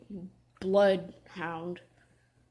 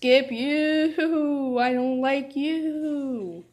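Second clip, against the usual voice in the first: disgusted; 6 semitones higher, 4 dB louder, much slower than usual. Real, not acted. Disgusted at enemy.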